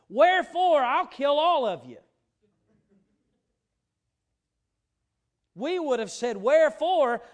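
loud, high-pitched pulpit shout; full-force preaching. Acted. Preacher voices an angry God to congregation, full-force preaching. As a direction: drive it home at full preaching volume.

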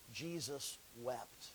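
Jesus wept, very soft and slow; hushed. Real, not acted. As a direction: let it sink to a near hush. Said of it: "solemn hushed verse".

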